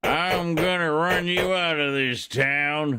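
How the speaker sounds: slow drawn-out gunslinger threat; cowboy outlaw. Pretend. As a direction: play the tough Western outlaw. Acting out Outlaw cowboy taunts.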